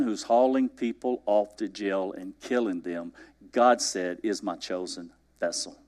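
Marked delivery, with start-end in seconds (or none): 4.2-5.8 hushed solemn. Acted. Repeated solemn words.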